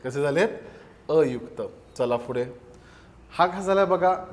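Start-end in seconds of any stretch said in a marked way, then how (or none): none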